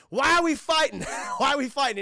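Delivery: hammed-up shout; mock shout. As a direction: yell it like a cheesy hero. Both heroes shouting.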